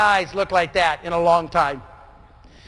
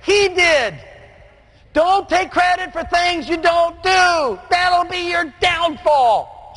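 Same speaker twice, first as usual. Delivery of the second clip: high, slowed, emphatic sermon delivery; fervent preaching; 5 semitones higher, slower than usual. Acted. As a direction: draw each line out like a revival climax. Preaching climax.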